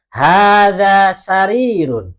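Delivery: slow, loud, drawn-out Arabic, every syllable of "Hada masjidun" stretched; slow model reading. Shown as a demonstration. Teacher modelling Arabic for the class, slow loud recitation. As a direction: chant it slowly for the class to repeat. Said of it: "yang lain ikutin".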